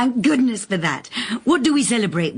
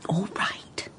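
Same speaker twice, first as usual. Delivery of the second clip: whisper; 9 dB quieter than usual. Acted. Giving in, in hushed aside.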